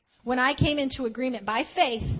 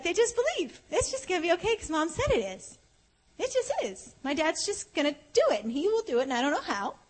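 put-on high, innocent child voice, much higher; little kid voice; 6 semitones higher than usual. Small child in the car trusting mom and dad, high childlike voice. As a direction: speak as a trusting little kid who just believes.

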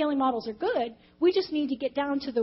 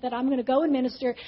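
ordinary delivery

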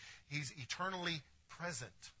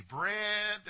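sudden recall; 8 semitones higher, 8 dB louder than usual. Retold or imitated. Forgetful man recalling shopping list.

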